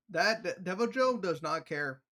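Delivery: ordinary delivery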